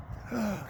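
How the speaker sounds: sharp high gasp; gasp of awe. Real, not acted. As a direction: gasp before the reveal. Marriage saved moment.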